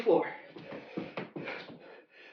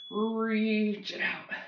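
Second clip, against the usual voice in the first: slow coaching cue; much slower than usual. Shown as a demonstration. Coach guiding a slow reach, drawn out. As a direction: draw out reach it out slowly. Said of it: "real slow".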